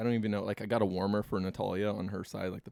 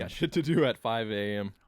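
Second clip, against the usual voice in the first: talking while chewing gum; speaking with gum in mouth; 6 dB louder than usual. Real, not acted. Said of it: "Let me get rid of my gum".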